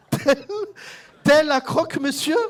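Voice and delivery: high, loud, breaking into laughter; can barely get the word out for laughing. Real, not acted. Comedian to audience, giggling over croque-monsieur.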